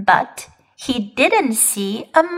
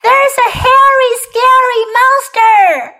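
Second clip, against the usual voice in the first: acted panicky yell; 11 semitones higher, 5 dB louder than usual. A narrator's reading. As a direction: shout it like a child raising the alarm. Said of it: "he yelled".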